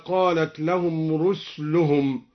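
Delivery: "رسلهم" said ruslu-hum, no vowel on the s; seen with sukun. Shown as a demonstration. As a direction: recite slowly, "رسلهم" closed on the s. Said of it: "وسكن السين من قوله تعالى". (تسكين سين "رسلهم")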